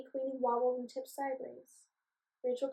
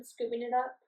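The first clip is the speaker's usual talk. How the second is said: usual speaking voice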